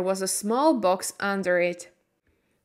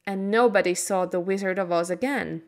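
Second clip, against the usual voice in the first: ordinary delivery, nothing marked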